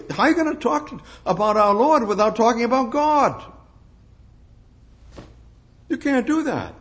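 high, rising, insistent plea; preacher pressing the point up high; fervent preaching. Real, not acted. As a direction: press the question hard like a preacher.

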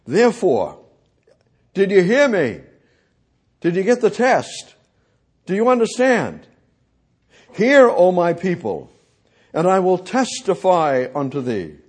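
loud, high preaching challenge; forceful preaching. Real, not acted. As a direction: preach it hard, pressing the congregation.

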